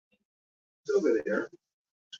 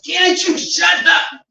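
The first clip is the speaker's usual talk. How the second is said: put-on high, loud, slow drunken yell; drunk shout; 9 dB louder, slower than usual. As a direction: bellow at the chatterers to shut up. Drunk guy yells at talkers.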